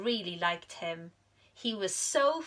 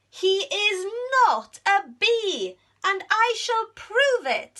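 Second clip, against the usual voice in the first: high, louder indignant vow; determined bee; 12 semitones higher, 8 dB louder than usual. Acted. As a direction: stand firm against everyone. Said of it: "said Amelia".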